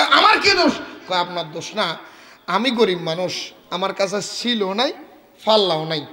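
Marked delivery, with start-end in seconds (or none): 0.0-0.7 higher, louder indignant question; indignant outburst. Acted. Shopkeeper protesting to the crowd, indignant surprise. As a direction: protest your innocence.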